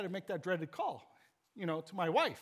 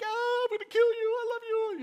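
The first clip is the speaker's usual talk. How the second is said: much higher, louder, half-joking cry of relief; mock furious relief; 6 dB louder than usual. Dad to found son, hammed-up angry-relieved cry. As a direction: yell then melt, like a rattled parent. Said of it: "We're like".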